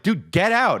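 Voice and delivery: put-on high, pleading call to the kid; a fed-up dad begging his son to come out; exasperated dad.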